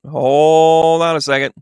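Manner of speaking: "Hold on a second" drawn out slowly with a long "hold"; slow stretched hold on. Real, not acted. Psychic stalling the chat while drawing a card, slow "Hold on a second".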